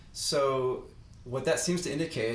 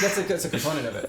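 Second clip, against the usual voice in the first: loud, quick objection through laughter; higher, rushed, laughing rebuttal; laughing protest; 4 semitones higher, 6 dB louder, much faster than usual. Real, not acted. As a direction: laugh while hurrying to correct. Laughing objection to co-host's joke.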